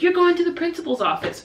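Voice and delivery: mildly hammed-up strict teacher threat; mock scolding yell. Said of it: "a lot of people would just yell".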